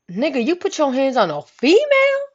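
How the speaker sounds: high, emphatic accusing question; acted-out indignant friend; outraged disbelief. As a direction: confront him in a retold outrage. Friend confronts the boyfriend.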